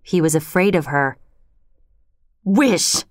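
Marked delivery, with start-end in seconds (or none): 2.5-3.0 emphatic one-word command; firm cry with some force; strong cry. A narrator's reading. Wife commanding her frightened husband, strong voice. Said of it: "she cried in a strong voice".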